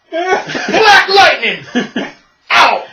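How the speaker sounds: drawn-out, high showman's holler; slow, swaggering exit cry. Retold or imitated. Snubbed hero announcing his exit, theatrical holler. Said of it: "he just does this. He goes".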